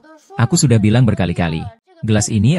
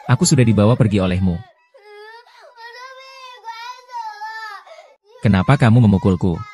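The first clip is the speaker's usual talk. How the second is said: put-on crying child. Child sobbing while accusing abuser.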